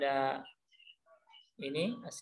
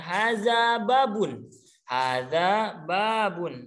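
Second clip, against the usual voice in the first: haza babun said slowly, word by word; slow model phrase; 3 semitones higher, much slower than usual. Shown as a demonstration. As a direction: draw out "haza babun" for the class to copy. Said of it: "siapa yang mau coba?". (ucapkan "haza babun" pelan dan jelas)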